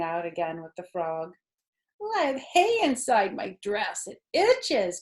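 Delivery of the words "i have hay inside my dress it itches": called out. A narrator's reading. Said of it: "called Fern".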